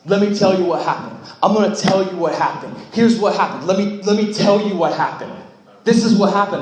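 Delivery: flustered camper. Retold or imitated. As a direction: camper caught out at night blurting excuses to the pastor, flustered and loud. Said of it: "the one guy's like".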